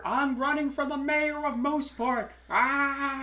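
high, loud movie-character voice; mock candidate announcement. Host mimicking a mayoral candidate, high and loud. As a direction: belt it out high, held-out cry at the end.

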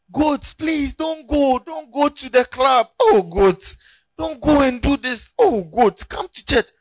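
high, loud begging shepherd calling the goats; shepherd pleading with stray goats, high and loud. Acted. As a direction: beg the goats like a desperate shepherd.

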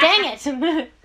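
loud, high mock curse; playful exclamation. Real, not acted. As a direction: blurt out a playful cuss. Mock cursing amid joking, laughter.

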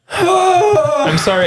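high, slow sighing sorry; drawn-out whiny sorry; mock apology. Player apologises in character.

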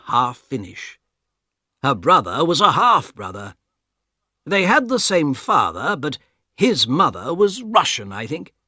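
put-on the old guard telling what he knows; calm guard voice. Guard recalls Elena.

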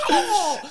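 very high squawked "Oh!"; hammed-up hurt seagull cry; mock bird yelp. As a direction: yelp like a bird that's just been chopped. Seagull hit in the neck.

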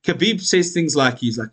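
normal talking voice

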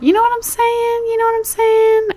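tossing the line up high, teasing; playful high voice. Acted. Host teasing her listeners in a high squeaky voice.

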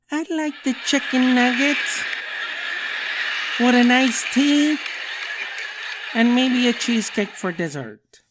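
put-on grown-up kid ordering. Little kid ordering food at the restaurant, prim and proud. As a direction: play a kid acting like a grown-up. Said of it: "And they're like".

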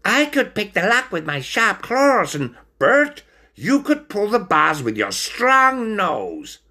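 high-pitched eager character voice; eager stoat. A narrator's reading. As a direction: offer a plan as an excited little stoat.

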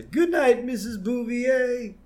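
high, slow mock old man voice; wistful old Abe, drawn out; imitating grandpa saying goodnight; old man farewell. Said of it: "And he says".

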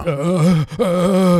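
high-pitched mock moans; hammed-up effortful groans; imitated moaning. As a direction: moan with exaggerated exertion.

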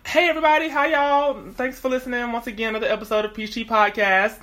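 high, upbeat host greeting; podcast host. Acted. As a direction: welcome the listeners with energy. Hosts the show opening.

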